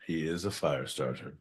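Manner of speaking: hushed repeat, said under the breath; quiet repeat. Shown as a demonstration. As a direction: drop to a hushed aside. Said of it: "repeated line".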